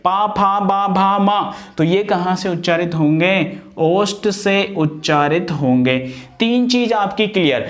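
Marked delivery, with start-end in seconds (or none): 0.0-1.5 पा फा बा भा मा made with the lips; pa-varga demo. Shown as a demonstration. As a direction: recite पा फा बा भा मा from the lips. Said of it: "देखिए पा".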